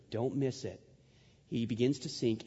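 ordinary delivery

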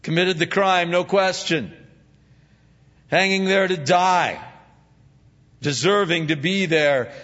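preaching at full force. Acted. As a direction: drive the point home to the congregation.

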